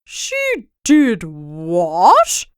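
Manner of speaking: very slow, drawn-out disbelief; king appalled by the smile, very slow; aghast king. Acted. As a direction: stretch the horror out. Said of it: "cried the king".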